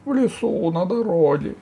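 high, squeaky ogre on the defensive; оправдывающийся людоед. A narrator's reading. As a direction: answer high and evasive, as the cornered ogre. Людоед оправдывается перед богатырём. (тонким голосом, оправдываясь)

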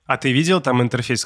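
normal talking voice

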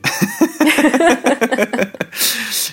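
high burst of laughter; loud laugh. Real, not acted. Laughing at the teacher's joke.

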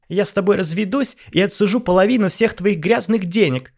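high, loud furious scream; screaming wife. Acted. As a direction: scream the threat at husband. Wife screams divorce threat.

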